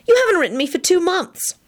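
high-pitched reproach; hurt, fretful complaint. A narrator's reading. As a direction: whine it like a neglected girlfriend. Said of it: "said fretfully".

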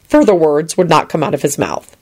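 plain reading voice

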